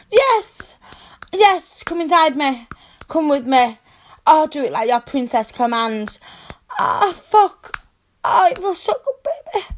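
high, loud cries of pleasure; ecstatic cries. Acted. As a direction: cry out, climaxing. Climax cries.

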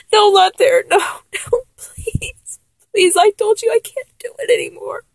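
high, crying voice that breaks to a whisper; tearful high begging, fading to breath; sobbing plea. Acted. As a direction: plead through sobs, voice cracking. Sobbing refusal during breakup.

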